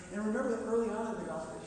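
ordinary talk, nothing marked

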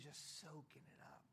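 hushed, breathy whisper. Acted. Pastor describing Mary to church, soft whisper. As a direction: drop to a whisper.

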